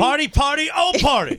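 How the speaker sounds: high, excited hype shout; party catchphrase call. Pretend. Co-host hyping the Thursday night football party, high excited call. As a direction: belt the catchphrase out high and loud.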